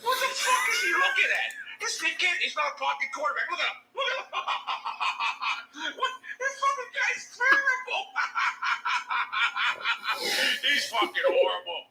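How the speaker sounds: high-pitched laughing jeers at the quarterback; laughing mockery. Real, not acted. Watching the game, mocking the quarterback through laughter. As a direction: cackle and jeer through it.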